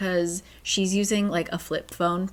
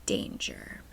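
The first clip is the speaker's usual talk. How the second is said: low, soft, whispered warning; ominous whisper; 8 dB quieter than usual. Shown as a demonstration. Revealing what the landscaper's word means, in an ominous whisper.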